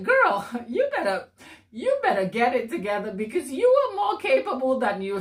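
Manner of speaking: mock-stern girlfriend pep talk, with a laugh; sassy pep talk. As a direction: playfully tell off a friend to buck her up. Acts out friend's pep talk.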